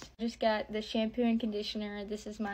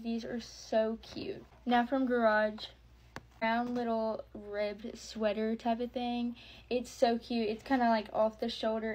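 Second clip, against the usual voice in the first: ordinary talk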